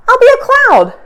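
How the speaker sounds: high, bright little-creature voice; excited high voice of the small porcupine; eager little porcupine. Acted. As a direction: chirp it like an eager young porcupine. Fluffy's hopeful little idea.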